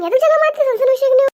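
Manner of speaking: high, loud reproach; exasperated scolding. Acted. Breaking up a couple's quarrel. (ಏರಿದ ದನಿಯಲ್ಲಿ ಗದರಿಕೆ)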